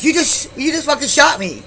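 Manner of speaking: high, loud, stumbling accusation; insistent disbelief. Retold or imitated. Woman to boyfriend denying he shot her, shocked insistence. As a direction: re-enact outraged insistence. Repeats accusation after his denial.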